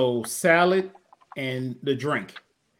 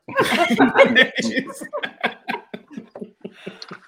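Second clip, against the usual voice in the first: laughing; 9 semitones higher than usual. Real, not acted. Laughs at own impression.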